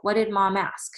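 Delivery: ordinary talk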